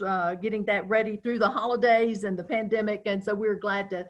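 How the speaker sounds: usual speaking voice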